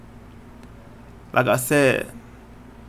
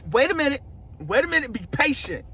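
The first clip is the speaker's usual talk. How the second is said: normal talking voice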